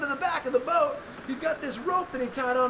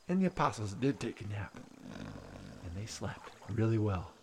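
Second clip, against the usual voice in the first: hushed storytelling; 4 dB quieter than usual. A narrator's reading. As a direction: let it drift in, gently and quietly. Quiet wind-down narration.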